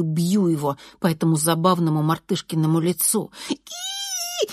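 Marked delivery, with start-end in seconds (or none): 3.5-4.5 very high, drawn-out piggy squeal; thin squeal. A narrator's reading. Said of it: "визжит он". (тонкий протяжный визг)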